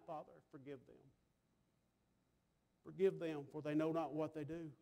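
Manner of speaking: soft, low plea to the Father; subdued prayerful pleading; quiet prayer. Acted. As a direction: pray it gently, hushed. Jesus praying from the cross.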